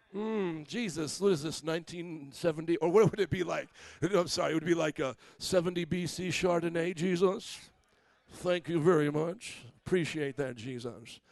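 hint of a snooty wine snob; wine connoisseur voice. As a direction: savor each word like a sommelier tasting. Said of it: "You have to talk like this when you're tasting fine wine".

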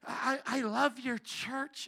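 slow, drawn-out complainer's voice; mock him with softened, stretched words; whiny offended churchgoer. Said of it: "but this is how he sounded".

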